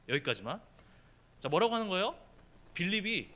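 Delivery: normal talking voice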